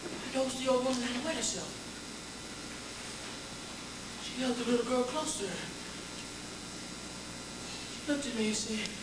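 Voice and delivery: very soft, subdued telling; hushed recollection. Acted. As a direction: drop to a hush after the chaos. Hushed aftermath in a dark room.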